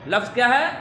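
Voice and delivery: ordinary talk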